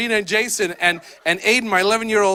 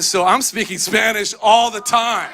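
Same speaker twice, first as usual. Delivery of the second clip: ordinary delivery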